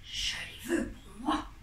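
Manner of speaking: greedy hushed whisper. Acted. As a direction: whisper it to yourself. Aunt covets eyes in secret.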